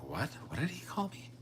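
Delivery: high, soft, put-on offended voice; mock offended. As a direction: squeak out shocked disbelief at the word. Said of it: "People be like".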